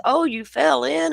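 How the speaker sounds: high, amused cop line; teasing cop voice. Retold or imitated. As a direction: tease it like the cop did. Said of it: "And the cop says".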